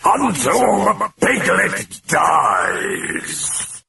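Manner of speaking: high, very slow raging roar; roaring demon. A narrator's reading. The demon roaring at the priest in high rage. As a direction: roar it out in fury. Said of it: "contorted in rage as it roared".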